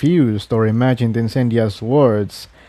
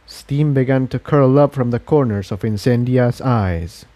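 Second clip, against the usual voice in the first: ordinary delivery, nothing marked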